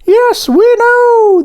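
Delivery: very high, loud, stretched-out donut cheer; shouted back. A narrator's reading. Donuts answer the baker's question, high squeaky drawn-out shout. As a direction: holler it slowly in a squeaky high voice. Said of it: "the donuts shouted back".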